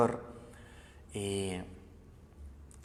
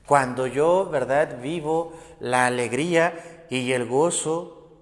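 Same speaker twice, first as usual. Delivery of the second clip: loud, rising preacher's call to the congregation; fervent preaching; 5 semitones higher, 9 dB louder than usual. Acted. Preacher urging the brothers, loud and fervent. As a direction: preach it out with full force.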